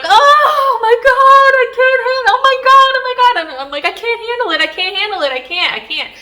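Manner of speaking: high, loud, overwhelmed cries of disbelief; retold wailing panic. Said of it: "vocally crying, like, wailing".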